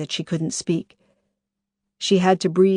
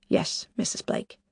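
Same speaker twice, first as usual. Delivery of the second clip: whispered reply; 6 dB quieter than usual. Acted. Shooks voiced answering on phone.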